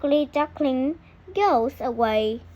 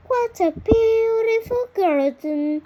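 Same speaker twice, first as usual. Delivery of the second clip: high, louder exclamation of wonder; awed duckling; 9 semitones higher, 5 dB louder than usual. A narrator's reading. Duckling sees the garden, high awed voice. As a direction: marvel at the garden. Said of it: "he says".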